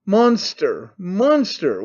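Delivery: restrained wail of outrage; mild wail. A narrator's reading. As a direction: give each word a mildly wailing fall. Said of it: "wailed Stepan Trofimovitch".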